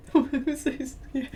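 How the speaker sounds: laughing through the question; amused disbelief at own fear. Real, not acted. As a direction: giggle out why was I scared.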